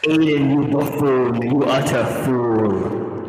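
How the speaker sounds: slow, grand mock insult; pompous scolding voice. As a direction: scold him like a haughty lord.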